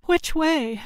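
high-pitched, lightly winded question; a touch of panting, acted. A narrator's reading. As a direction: ask it a little out of breath.

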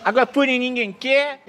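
loud mocking vendor chant; singsong beach vendor call. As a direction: shout it back like a rival vendor.

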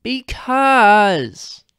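long drawn-out whine; whined. A narrator's reading. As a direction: stretch the word like a sulky kid. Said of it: "Linda whined".

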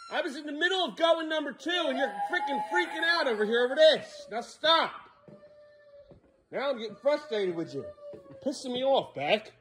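high, strained exasperated scolding; dad telling off his son; frustrated scolding. Real, not acted. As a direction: let the frustration show.

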